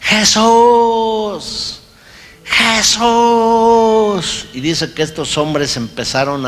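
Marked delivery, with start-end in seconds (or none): 0.0-4.5 desperate high shout of the name; crying out Jesus. Retold or imitated. Prisoner crying out to Jesus in the dark, desperate shout. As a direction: cry out to Jesus as if your life depends on it. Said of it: "y empezó a gritar".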